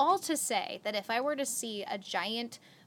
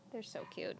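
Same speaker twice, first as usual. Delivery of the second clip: normal talking voice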